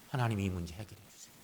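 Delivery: low, hushed acted petition; imagined prayer, murmured low; quiet prayer plea. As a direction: sink into a soft plea to God. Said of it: "그러면서 하나님께 아뢰는 거죠".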